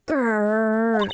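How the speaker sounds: drawn-out voiced tiger growl. Tiger closing in on the pair, drawn-out growl. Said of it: "I do a great impersonation of a tiger".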